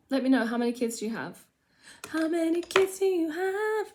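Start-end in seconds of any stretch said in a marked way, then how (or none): 2.1-3.9 silly voice. Pretend. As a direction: put on a high, silly voice. Echoes own question in silly voice.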